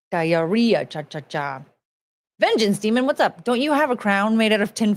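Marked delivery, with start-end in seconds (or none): none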